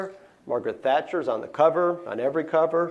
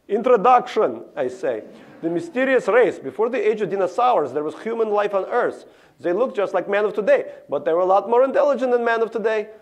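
put-on boy reading his novel aloud, louder, thick Russian accent; 7 semitones higher, 5 dB louder than usual. Boy reading his novel to the class, loud Russian accent. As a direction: declaim the intro like an eager Russian schoolboy. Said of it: "my Russian accent is still thick".

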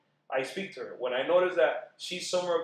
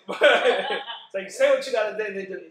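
laughing; 7 semitones higher, 9 dB louder than usual. Real, not acted. Husband laughing at his wife's remark, laughing. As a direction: laugh it out while talking.

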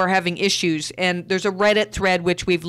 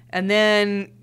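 ordinary talk

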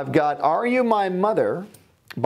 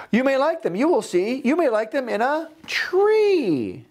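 high coaxing Sam; 11 semitones higher than usual. Acted. Sam coaxes the old man.

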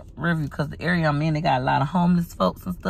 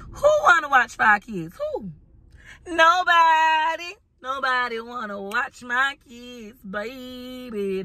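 high squeak then slow drawn-out nobody; sassy emphasis; 6 semitones higher, slower than usual. Real, not acted. Mother of five dramatizes nobody babysitting.

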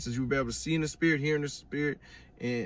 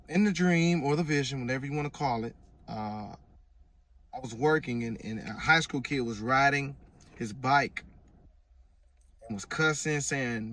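normal talking voice

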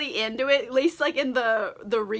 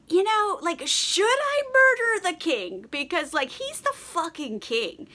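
high, drawn-out wavering question; having second thoughts; 7 semitones higher than usual. Retold or imitated. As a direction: voice a retold hesitant murderer, pitched up. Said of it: "second thoughts about things".

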